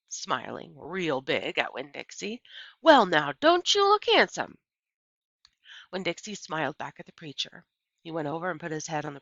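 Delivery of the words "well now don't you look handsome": high, loud coo at the dog; fond praise. Acted. Preacher praising the clean dog, high fond coo. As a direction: admire the dog like a proud owner.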